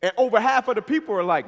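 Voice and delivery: ordinary talk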